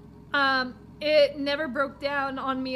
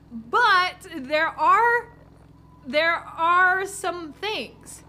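slow, drawn-out, louder hint; ominous slow warning; 3 semitones higher, 5 dB louder, much slower than usual. Acted. Driver hinting about monsters, slow and ominous. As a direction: draw it out like a dark secret.

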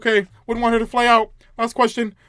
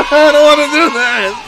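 loud, higher laughing protest; nervous laughing; 8 semitones higher, 11 dB louder than usual. Real, not acted. Player dreading the next room, nervous laughing. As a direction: blurt it loudly through a laugh.

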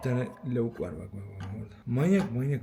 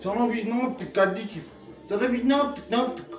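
mock scolding; 6 semitones higher, 4 dB louder than usual. Scolding the angry cat.

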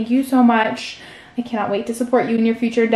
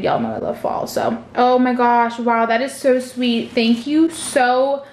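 usual speaking voice

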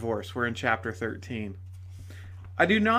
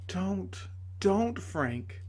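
higher, soft, gentle pleading; 6 semitones higher, 4 dB quieter, much slower than usual. A narrator's reading. Lady begging Frank to stop, soft plea. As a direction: hush him gently.

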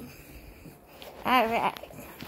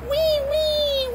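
high squealed mock wail; pretend bear crying; 12 semitones higher than usual. Playing little bear after "go", high pretend wail. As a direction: fake sobbing, tiny and high. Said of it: "Little bear go".

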